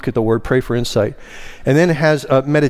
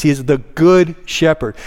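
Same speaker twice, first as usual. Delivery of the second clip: "good" hit hard and held; stress on GOOD; 2 semitones higher than usual. Shown as a demonstration. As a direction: preach it with the weight on "good". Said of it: "That word good actually means".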